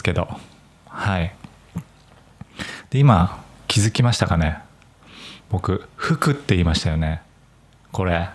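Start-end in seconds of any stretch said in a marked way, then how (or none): 5.5-7.2 服 said with Nagoya pitch accent; 名古屋アクセントで「服」; Nagoya accent on fuku. Shown as a demonstration. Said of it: "名古屋のアクセントなんです".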